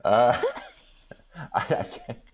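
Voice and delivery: laughing it off, voice jumping high; amused, breathy laugh at a trick question. Real, not acted. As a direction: giggle through the words, caught off guard.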